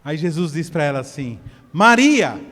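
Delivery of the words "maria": firm call, a little louder; calling out her name. Shown as a demonstration. Pastor shows Jesus calling Mary firmly. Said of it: "será que ele falou assim".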